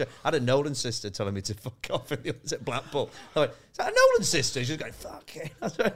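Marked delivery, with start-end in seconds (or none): 3.7-4.6 surprised question. Retold or imitated. Spotting a [name] sister, astonished. 4.9-5.5 breathy whispered swear under the breath; whispered curse. Retold or imitated. Retelling a [name] sister cursing in the Blackpool office, whispered.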